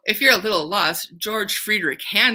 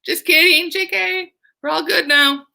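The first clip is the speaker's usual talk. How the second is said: very high, playful "just kidding"; teacher voicing soldiers joking with Tonio, very high and playful; teasing soldiers; 11 semitones higher than usual. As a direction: tease him like a jokey soldier. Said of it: "they're like".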